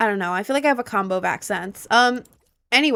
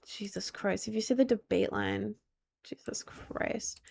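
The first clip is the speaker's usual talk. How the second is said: whispered curse; 15 dB quieter than usual. Real, not acted. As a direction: breathe it out under the breath. Muttered reaction at the game.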